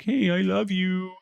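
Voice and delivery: hint of a goofy adoring creature; friendly mob voice. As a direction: coo it like a lovestruck mob. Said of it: "He was all like".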